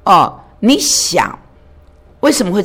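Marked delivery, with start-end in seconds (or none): none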